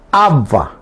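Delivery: ABba, first A stressed, falling away; "abba" with a falling second syllable. Shown as a demonstration. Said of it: "En la primera A lleva la tilde".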